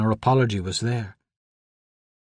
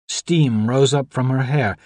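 ordinary delivery, nothing marked

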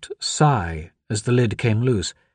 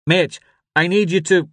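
raised, urgent radio voice; urgent father; 8 semitones higher, 4 dB louder than usual. A narrator's reading. Father instructs the deputy over the radio, urgent. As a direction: give an order over background noise. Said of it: "radio popped with his father's voice".

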